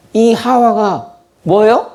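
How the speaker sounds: high, slow marvelling question; delighted wonder. Retold or imitated. Adam marvelling at Eve's goodness, slow delighted question. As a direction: savour each word in awe. Adam raves about Eve.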